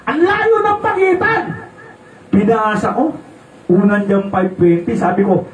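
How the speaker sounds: usual speaking voice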